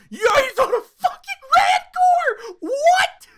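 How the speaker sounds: high, loud, slow fan scream; ecstatic scream. Retold or imitated. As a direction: scream it like the Rancor just appeared. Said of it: "I started scre-, I went".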